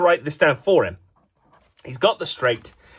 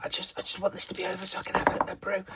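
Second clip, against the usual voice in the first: breathless, softer dry whisper of a thirsty player; parched whisper; 9 dB quieter, faster than usual. Acted. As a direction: croak it out like you're dying of thirst. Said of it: "you're thirsty".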